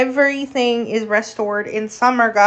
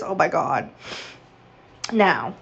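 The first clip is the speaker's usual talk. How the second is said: ordinary delivery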